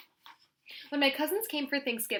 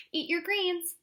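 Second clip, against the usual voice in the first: high, fussing grandma voice; mother a grown daughter at dinner; bossy grandma; 8 semitones higher, 3 dB louder, slower than usual. Acted.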